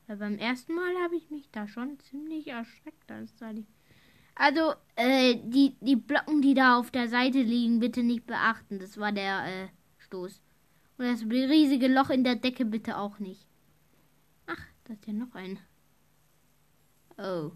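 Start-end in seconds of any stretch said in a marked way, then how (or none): 4.3-8.8 loud. Real, not acted. Asking viewers to ignore blocks. 11.0-13.5 loud. Real, not acted. Asking viewers to ignore hole.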